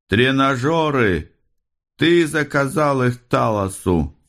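high, drawn-out cry past an obstruction; mumbling round a coin. A narrator's reading. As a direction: call out high despite a coin in the mouth. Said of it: "Он говорил невнятно, мешала монета". (высоко, нараспев, с монетой во рту)

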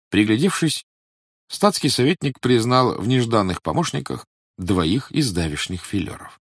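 usual speaking voice

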